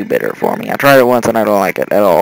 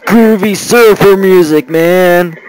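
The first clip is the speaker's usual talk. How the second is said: loud, higher surfer dude drawl; a loud, high-pitched surfer dude taking in the music; surfer accent; 7 semitones higher, 8 dB louder, slower than usual. Pretend. Game commentator hams up a surfer accent, loud and high. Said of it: "I cannot do the accent to save my life".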